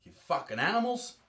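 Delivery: ordinary talk, nothing marked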